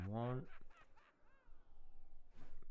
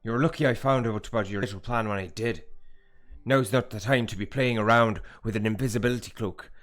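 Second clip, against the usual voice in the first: loud, forceful mentor in a put-on accent; urgent, commanding character voice; Mad Eye Moody; 4 semitones higher, 14 dB louder than usual. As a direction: lecture sternly at full volume in character. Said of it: "I nailed the Mad Eye Moody accent".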